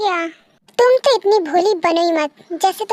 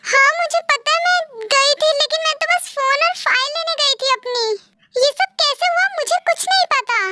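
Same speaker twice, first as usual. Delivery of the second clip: much higher, bewildered and defensive; shocked denial; 7 semitones higher than usual. Acted. V faces the recording, shocked and pleading her innocence. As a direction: stammer out your innocence in disbelief.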